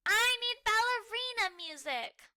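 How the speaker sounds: high, loud bossy yell; screamed demand. Acted. Muffin demanding music from everyone, a mock scream. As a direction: yell it like a spoilt toddler. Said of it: "Muffin screams".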